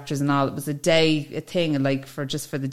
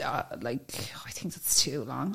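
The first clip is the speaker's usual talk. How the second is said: much softer unvoiced aside; whispered aside; 4 semitones lower, 11 dB quieter than usual. Real, not acted. Confiding her view on English funeral delays, in a whisper.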